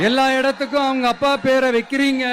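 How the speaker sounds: loud campaign oratory over a crowd; rally speech. Real, not acted. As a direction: rouse the Tiruvarur crowd, mocking the CM. Loud rally oration in Tiruvarur. (உரத்த பிரச்சார மேடைப் பேச்சு)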